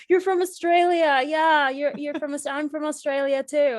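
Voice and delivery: high, loud imitation of an excited drunk; drunk guy. Drunk guy to elevator operator about Australia, loud high imitation. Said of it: "he was super drunk and he's like".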